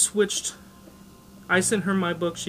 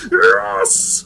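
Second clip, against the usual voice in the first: triumphant shout; 2 semitones higher, 12 dB louder than usual. Pretend. Imagined hero hitting enter.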